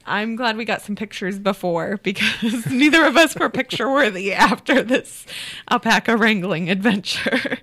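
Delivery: laughter breaking into the words; amused, laughing. Real, not acted. Guest recalls a sweaty alpaca chase to interviewer, laughing. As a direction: tell it through real chuckles.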